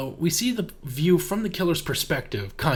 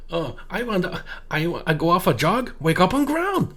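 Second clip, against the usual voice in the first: mock broken-English foreign accent, rising at the end; terrible fake accent; 3 semitones higher than usual. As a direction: imitate a badly faked foreign accent. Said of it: "completely terrible accent".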